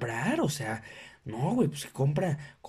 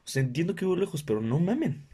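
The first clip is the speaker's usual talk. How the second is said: ordinary delivery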